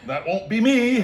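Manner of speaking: high, anxious little promise; squeaky nervous vow. Retold or imitated. Telling the guide he won't be last, nervously high. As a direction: pipe it up nervously. Vowing not to come last.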